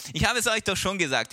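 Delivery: lightly acted impatience; fed up. Healed man answering his questioners, fed up. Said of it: "wird's dem halt langsam auch zu blöd".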